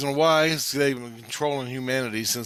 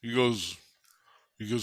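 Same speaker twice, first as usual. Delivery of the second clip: ordinary talk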